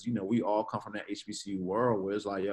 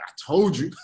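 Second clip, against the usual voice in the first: imitated I-told-you-so; 5 semitones higher, 6 dB louder than usual. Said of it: "he gonna be like".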